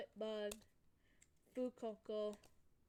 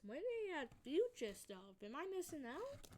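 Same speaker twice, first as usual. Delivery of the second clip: high, incredulous rising questions; real surprise; 7 semitones higher than usual. Real, not acted. Card opener just finding out about future cards, surprised. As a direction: voice leaping up, as if left out of the news. Discovers cards they did not know about.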